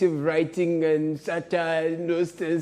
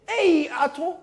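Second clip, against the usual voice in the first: catchphrase shout; 10 semitones higher than usual. Pretend. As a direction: call out Ato across the room. Catchphrase again after clip.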